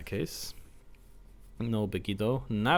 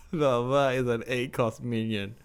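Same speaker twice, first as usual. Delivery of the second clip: laughing; 3 semitones higher, 5 dB louder, slower than usual. Real, not acted. Laughing at opponent's misplay.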